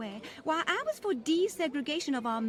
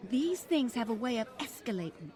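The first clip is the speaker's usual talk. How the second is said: ordinary talk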